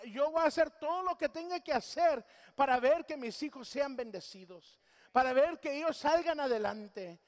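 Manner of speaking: loud, rising fervent vow; fervent pledge. Retold or imitated. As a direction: proclaim it like a man giving his word. Voicing a godly man's pledge. (promesa ferviente y fuerte)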